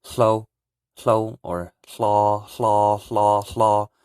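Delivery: "hlo" with breathy H puffed out before the L; teacher drilling "hlo" syllable; Hmong hlo. Shown as a demonstration. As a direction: breathe the H then slide into L on "hlo". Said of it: "You just say".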